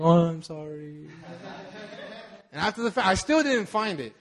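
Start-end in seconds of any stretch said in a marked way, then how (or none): 0.0-1.1 slow, drawn-out retold apology; mock-tender apology. Husband hugging it out with wife, mock-tender apology. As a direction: stretch the apology out soppily. Acts apology during the hug.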